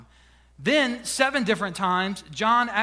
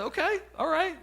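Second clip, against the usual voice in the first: high-pitched, softer okay; mock compliance; 8 semitones higher, 4 dB quieter than usual. Husband answering wife's hint, high mock-compliant voice. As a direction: go up high in playful, acted agreement.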